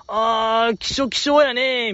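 high, drawn-out mocking quote; playful high-pitched jeer, imitating someone; foul-mouthed teasing. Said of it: "ちょっとやっぱ口悪いところ".

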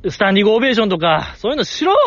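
high-pitched pretend demand; heckle, pitch leaping on the final order; mock rough heckling. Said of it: "かなり語気荒めに".

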